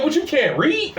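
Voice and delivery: high-pitched, irritated retort; pissed off. Retold or imitated. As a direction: fire back a fed-up question, pitch jumping up. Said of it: "I was just getting pissed off".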